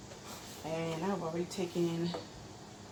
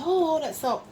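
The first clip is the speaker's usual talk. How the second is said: high, loud mock complaint; mock alarm; 10 semitones higher, 5 dB louder than usual. Imagined viewer objecting to the seasoning, in loud mock alarm. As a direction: exclaim it like a scandalized critic. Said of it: "so don't say".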